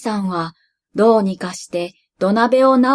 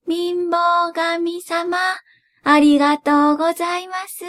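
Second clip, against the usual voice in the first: put-on old woman voice; 7 semitones higher than usual. A narrator's reading. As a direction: thank him as the old woman. Grandmother thanks poverty god.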